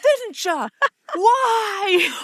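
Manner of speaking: high accusation rising into a mock wail; hammed-up movie intensity. Guy confronting the other in Poltergeist, hammed-up wail. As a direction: pitch it way up and cry out the last word. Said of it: "the intensity of that part of the movie".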